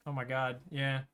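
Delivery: normal talking voice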